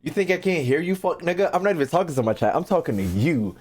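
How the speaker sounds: ordinary talk, nothing marked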